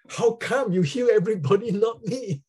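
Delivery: high, loud mock complaint to God; exasperated plea. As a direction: protest to God, half laughing at yourself. Retold complaint to God, half laughing.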